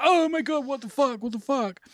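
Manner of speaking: high shocked outburst, then dropping; mock the boys' shocked yelps; boys freaking out. Schoolboys reacting to the naked scene, shocked. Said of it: "all boys with them".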